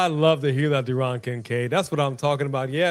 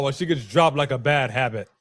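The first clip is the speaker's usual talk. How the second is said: usual speaking voice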